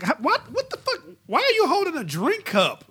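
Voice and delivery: high, incredulous mock outburst with a laugh; shocked disbelief. To a friend, retelling seeing the drink cup, high incredulous shock. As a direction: play your own baffled shock at the sight.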